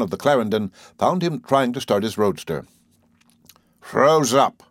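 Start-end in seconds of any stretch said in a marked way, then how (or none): 3.8-4.6 higher, emphatic pronouncement; wise. A narrator's reading. Perry explaining why the roadster won't start, knowingly. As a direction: say it like a knowing diagnosis. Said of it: "said Perry wisely".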